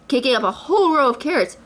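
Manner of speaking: ordinary delivery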